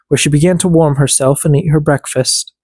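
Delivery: usual speaking voice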